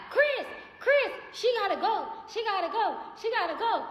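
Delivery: high, loud frantic repeating; mock frantic lady. Imitating the lady screaming for Chris, high and loud. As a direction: squeal her panic in a high voice. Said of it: "she was like".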